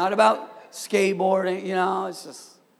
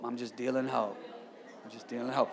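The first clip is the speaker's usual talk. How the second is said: low, hushed dealer's pitch; mock street dealer; 8 semitones lower, 10 dB quieter, faster than usual. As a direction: play a dealer offering it on the quiet. Plays street dealer offering hope.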